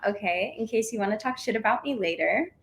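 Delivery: ordinary talk, nothing marked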